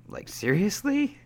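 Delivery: high-pitched rising disbelief; incredulous. Real, not acted. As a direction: voice leaps up in disbelief. Disbelief at the match result.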